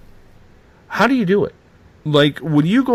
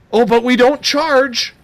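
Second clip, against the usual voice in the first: mocking quote; 7 semitones higher, 5 dB louder than usual. Mocking the investigators' claim.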